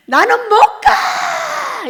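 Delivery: high wailing shout, stretched out; crying shout. Retold or imitated. Crying out in heaven that she will not go, wailing shout. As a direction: wail it out like refusing to die. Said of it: "소리를 지르면서 우는데".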